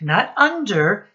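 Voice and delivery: ordinary delivery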